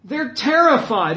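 usual speaking voice

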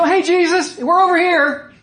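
high, loud holler to someone across the water; cheery calling out. Acted. Preacher mocks the disciples greeting Jesus, cheery shout. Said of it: "they didn't have this reaction".

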